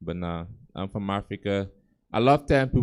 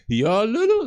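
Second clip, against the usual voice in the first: surprised; 12 semitones higher, 6 dB louder, slower than usual. Retold or imitated. Client surprised at meeting him.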